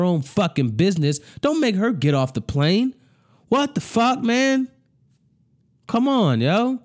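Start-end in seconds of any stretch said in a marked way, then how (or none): 3.5-6.8 outraged disbelief. Real, not acted. Outraged at airline.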